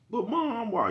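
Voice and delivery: put-on high whiny child asking why; high pleading kid voice. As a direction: pitch up like a nagging kid. Voicing a questioning kid.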